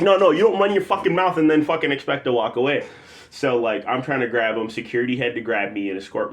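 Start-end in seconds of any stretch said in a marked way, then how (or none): 0.0-2.8 raised, louder retold anger; heated comeback, pitched up; angry confrontation. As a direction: lay into him, re-enacting the row. Acting out own angry reply.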